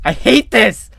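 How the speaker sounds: high, loud cry of frustration; frustrated outburst. Real, not acted. Reader fed up with a sentence, outburst. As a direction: blurt it out, fed up.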